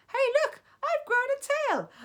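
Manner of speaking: put-on very high, loud girl's cry; sick girl yelling to the class; yelling schoolgirl. A narrator's reading. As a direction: squeal in alarm.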